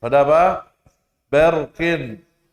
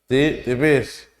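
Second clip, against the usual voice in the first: usual speaking voice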